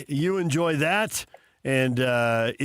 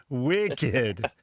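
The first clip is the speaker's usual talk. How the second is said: "wicked" in a hammed-up Boston accent, r-less and drawn out; mock Boston accent. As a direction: imitate a Boston local saying "wicked". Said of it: "a wicked Boston accent".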